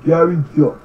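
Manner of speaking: ordinary delivery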